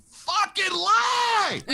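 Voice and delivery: high, drawn-out comic scream; inner voice shouting. Acted. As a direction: scream it like a desperate voice inside your head. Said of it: "I had that one voice going".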